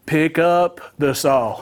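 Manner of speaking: slow, measured instruction; imitating a dad slowing things down; deliberately slow. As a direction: spell it out slowly, word by word. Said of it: "take this a little bit slower".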